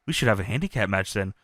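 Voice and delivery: low, soft and fast mock fury; seething under breath. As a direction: grumble it in disbelief. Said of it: "gets so mad".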